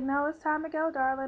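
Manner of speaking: high, loud sing-out of a nurse hurrying someone along; insistent nurse. Retold or imitated. Nurse telling patient it's time for surgery, insistent coaxing. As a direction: imitate staff firmly coaxing a patient. Staff insisting she goes now.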